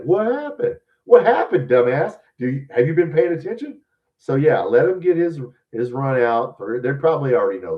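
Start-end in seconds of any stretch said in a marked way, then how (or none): 0.0-0.8 much higher, clueless cry of surprise; bewildered fans. Retold or imitated. Duped fans asking after the coach leaves, high mock bewilderment. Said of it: "and they'll be like". 1.0-3.8 loud, high mocking comeback, easing slightly; exasperated retort. Scolding fans fooled by the coach, exasperated mocking retort.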